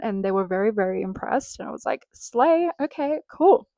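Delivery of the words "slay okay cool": high, pleased exclamation; playful high-pitched self-congratulation; mock smug delight. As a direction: toss it off, chuffed with yourself. Retold smug reply after compliment.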